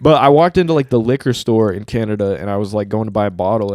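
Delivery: ordinary talk, nothing marked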